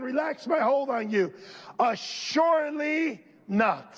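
from a plain "I will not" rising to a high shouted "I will not leave you"; building to a shout. Acted. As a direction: climb to a roar.

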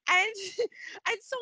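usual speaking voice

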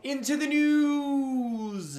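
high, loud, hugely drawn-out announcer shout; Keemstar. Acted. Friend parodying a YouTuber's catchphrase, long mock yell. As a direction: bellow and stretch the last word. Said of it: "Killer Keemstar".

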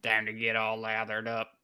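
lightly voiced cafeteria lady; lunch lady voice. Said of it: "Why does she sound like a lunch lady?".

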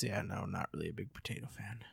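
fast unvoiced whisper. Real, not acted. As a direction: drop to a quick whisper. Whispered aside.

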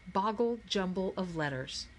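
plain reading voice

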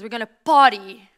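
very loud, rallying "party"; loud party shout. Acted. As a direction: shout it like a celebration. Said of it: "preacher's loud party call".

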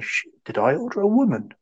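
high-pitched puzzled question; mock bewilderment. Imagining a politician working it out, mock bewilderment. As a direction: voice leaps up, pretending to be baffled. Acting out Pence's puzzled inner thought.

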